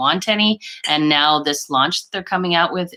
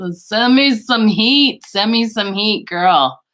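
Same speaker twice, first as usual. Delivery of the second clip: drawn-out, high, loud begging; playful plea; 6 semitones higher, 5 dB louder, slower than usual. Real, not acted. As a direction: stretch it out like you're freezing and pleading. Begging viewer for warm weather.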